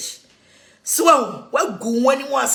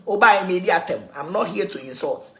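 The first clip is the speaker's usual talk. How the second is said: normal talking voice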